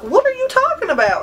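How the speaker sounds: high-pitched baffled comeback; mock bewilderment. Vlogger answering a stranger in a store, mock bewilderment. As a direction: pitch it way up, playfully incredulous. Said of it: "And I'm like".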